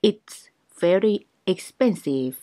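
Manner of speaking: very slow, each syllable clear; slow teaching pace; slow drill. Shown as a demonstration. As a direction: spell it out slowly for learners.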